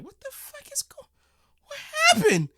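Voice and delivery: very high, squeaky disbelief; shocked German gunner. Retold or imitated. German soldier returning to his wrecked gun, squeaky shock. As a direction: squeal it like you can't believe your eyes.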